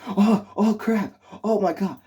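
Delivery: high, loud panicked exclaiming; freaking out. Acted. Movie reviewer showing how he should have reacted, freaking out. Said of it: "freaking out, like".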